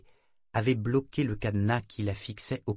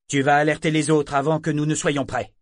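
sharp rebuke; 5 semitones higher, 7 dB louder than usual. Acted. Saadar fait taire Yémin.

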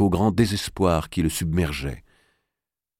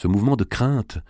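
ordinary delivery

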